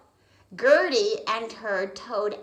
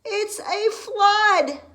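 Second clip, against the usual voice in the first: alarmed; 11 semitones higher, 8 dB louder, slower than usual. Acted. Frog alarmed by flood.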